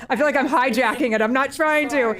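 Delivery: high, loud laughing words, close to tears; emotional laughter. Real, not acted. Board member laughing emotionally while thanking the community. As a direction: laugh through rising emotion. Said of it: "I'm getting emotional".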